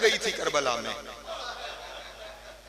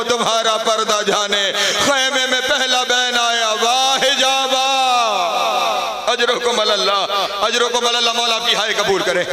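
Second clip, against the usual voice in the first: high, loud keening cry; loud wailing lament; 3 semitones higher, 6 dB louder than usual. Acted. Zainab weeps to the gathered women, loud high wailing. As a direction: wail it out at full force, higher. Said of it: "और रोकर कहा".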